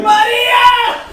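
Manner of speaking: put-on drunk yell. Comic yelling a name drunk in a Latin club, high and loud. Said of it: "just yelling".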